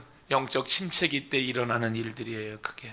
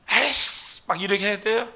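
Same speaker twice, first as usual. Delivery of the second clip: irritated outburst; 8 semitones higher, 9 dB louder than usual. Retold or imitated. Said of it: "막 짜증 부려야 돼요".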